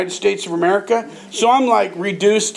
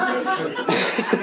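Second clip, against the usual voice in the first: laughter; 5 semitones higher, 6 dB quieter than usual. Real, not acted. After the reveal.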